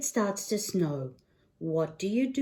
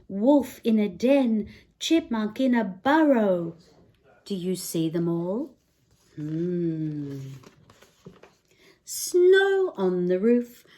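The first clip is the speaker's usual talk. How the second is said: lively picture-book read-aloud; singsong bedtime book voice; reading to children; 5 dB louder than usual. Acted. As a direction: read it like storytime for a little one.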